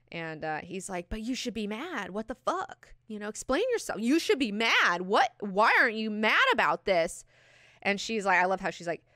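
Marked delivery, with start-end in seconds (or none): 1.1-7.3 higher, building demand; hammed-up goading, growing louder; acted indignation. Acts out Javid goading her.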